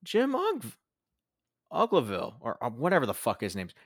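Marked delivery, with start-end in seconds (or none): 0.0-2.4 fake surprise. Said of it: "Jerry's like".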